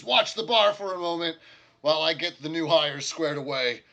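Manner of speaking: brisk instructions to the barmaid; innkeeper voice. Acted. Orc innkeeper telling the barmaid to watch the bar, loud.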